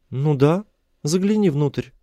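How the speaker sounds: high female character voice; high-pitched woman replying; captive woman. A narrator's reading. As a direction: answer in a high, light woman's voice.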